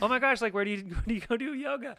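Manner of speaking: high, fast, gushing mock tipsy chatter; husband imitating his tipsy wife, bubbly and gushing. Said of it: "you were so happy and bubbly".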